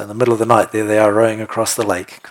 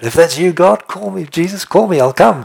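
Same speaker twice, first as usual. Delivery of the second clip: high, pleading call to God; acted eager plea; 7 semitones higher, 4 dB louder than usual. Acts out Peter's eager plea.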